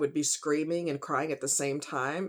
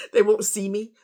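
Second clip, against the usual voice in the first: words breaking into a real, louder sob; crying; 3 semitones higher, 8 dB louder than usual. Real, not acted. Hiding her pain from a stranger in the pharmacy, a real crying break. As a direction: let the tears come through.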